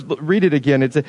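usual speaking voice

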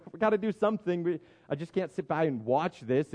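ordinary delivery

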